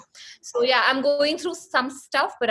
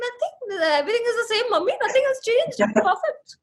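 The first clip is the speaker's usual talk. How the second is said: put-on high, tearful and defensive teen; whiny teen denial; 11 semitones higher than usual. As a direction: plead innocence in a high whine. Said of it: "And I was like".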